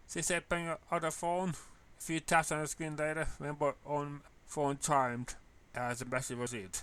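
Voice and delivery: ordinary delivery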